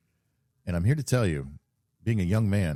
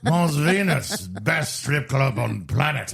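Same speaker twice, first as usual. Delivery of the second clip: slow, louder, higher mock-French proclamation; French accent; 6 semitones higher, 4 dB louder, slower than usual. French businessman declaring the best club on the planet, hammed-up French accent. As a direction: draw it out like a grand French pronouncement. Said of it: "in a very thick, uh, French accent".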